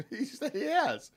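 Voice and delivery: high-pitched laughing repeat. Real, not acted. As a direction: crack up while vouching for him. Laughing at Greg's ghost claim.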